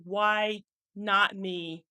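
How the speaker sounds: Why not me? stretched out very slowly, each word weighed; slow and deliberate. Shown as a demonstration. Coach modelling the affirmation for listeners, slow and deliberate. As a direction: let Why not me? land slowly and firmly. Leading audience to the new choice.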